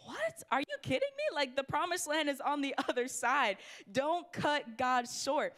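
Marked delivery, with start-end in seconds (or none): 0.1-1.3 preacher mocking grumblers with a sky-high squeak; incredulous. Mock disbelief at the Israelites. 3.9-5.5 spaced out, every word weighted; preacher repeating the refrain, very slow; slow emphatic. Real, not acted.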